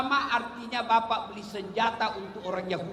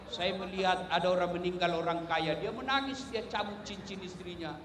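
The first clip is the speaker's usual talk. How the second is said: normal talking voice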